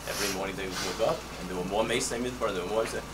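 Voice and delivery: ordinary delivery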